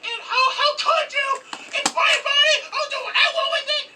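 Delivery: hammed-up hysterical girlfriend shriek; mock angry girlfriend. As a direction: rant like an offended woman screaming.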